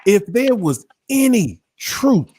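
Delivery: punched, high-swinging preacher cadence; emphatic preaching. Acted. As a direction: drive it home like a preacher, with dramatic pauses. Preaches the point home.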